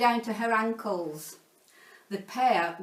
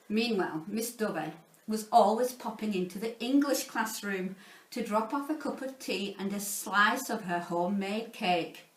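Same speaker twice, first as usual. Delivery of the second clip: ordinary delivery, nothing marked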